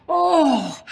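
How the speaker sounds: high, sudden gasping cry; gasp of wonder. Acted. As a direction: gasp as the rocket bursts. A rocket bursts overhead.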